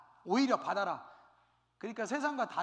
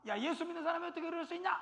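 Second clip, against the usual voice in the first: mocking scoff; 8 semitones higher, faster than usual.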